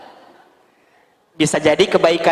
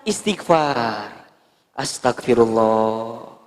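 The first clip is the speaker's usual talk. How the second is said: low, slow istighfar; slow pious sigh; 8 semitones lower, 4 dB quieter, much slower than usual. Shown as a demonstration. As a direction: draw it out low to calm down. Slow pious self-calming. (istighfar pelan dan rendah)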